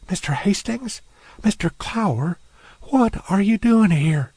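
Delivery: Rachel, surprised. Acted. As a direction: recognise the men with surprise. Rachel voiced as character.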